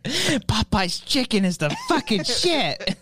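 put-on slow, high drunken declaration; hammed-up tipsy proclamation. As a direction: imitate a drunk raving, words dragged out. Continuing the drunk voice bit.

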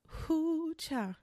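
high, soft playful exclamation; quiet, high teasing hoo-cha. Real, not acted. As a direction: toss out a cheeky little whoop. Playful exclamation after bad bitches.

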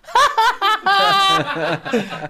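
loud, high mock cackle; imitated laughing fit. Police operator laughing at caller who phoned on himself, imitated cackle. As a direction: burst into someone else's helpless laughter. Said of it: "And she just goes".

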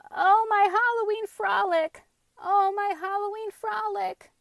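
high, loud lamenting wail; mournful wail. A narrator's reading. Little girl losing her Halloween frolic, mournful wail. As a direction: wail it like a weeping child. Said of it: "she mourned".

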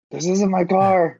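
high, drawn-out mock yell; hammed-up panicky denial; panicked protest. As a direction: act out pleading innocence to a cop. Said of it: "So I'm like".

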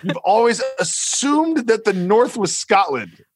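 high, slow and incredulous. Real, not acted. Repeating co-host's Scotland assumption back, incredulous. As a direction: draw it out high in disbelief.

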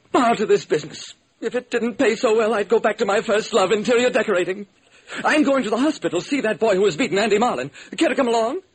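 a dainty producer making small talk; fussy studio man. Acted. Producer inviting the detective along, in a high chatty voice.

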